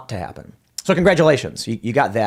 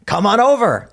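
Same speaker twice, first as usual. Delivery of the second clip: higher, louder invitation across the room; calling over; 9 semitones higher, 4 dB louder than usual. Retold or imitated. The guy across the pit invites Tim to join, loud friendly call. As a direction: wave someone over with your voice.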